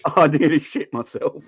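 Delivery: retelling a friend's line through laughter; quoting Jack's WhatsApp while laughing; laughing quote. Retold or imitated. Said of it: "And he just went".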